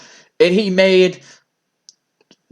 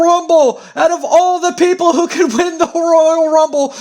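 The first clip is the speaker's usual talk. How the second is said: excited announcer shout; 7 semitones higher than usual. Acted. Announcer screaming to the crowd that the jobber won. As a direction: call the upset win like a stunned ring announcer. Announcing shock Royal Rumble winner.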